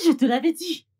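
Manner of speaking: I told you so, pushed up loud; insistent scolding. Acted. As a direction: rub it in, raising the voice. Scolding, repeats the phrase.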